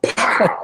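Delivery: loud, high "pow"; explosive vocal sound effect; imitated door kick. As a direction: burst it out like a karate chop. Imitates door being kicked open.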